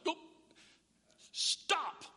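high, loud, sharp order to stop; angry dad yell. Retold or imitated. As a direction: cut the kids off like a furious dad. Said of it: "I said it like that".

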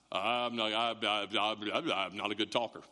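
low, stuttering reluctant Moses; [name] stammer. Acted. Moses excusing himself to God, low stammer. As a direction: stammer haltingly on a low, even pitch. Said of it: "I picture [name] for some reason".

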